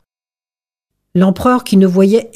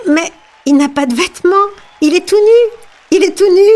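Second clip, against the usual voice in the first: put-on child shouting; 10 semitones higher, faster than usual. A narrator's reading. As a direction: blurt out the truth like a small child. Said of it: "un enfant cria". (cri d'enfant aigu et fort)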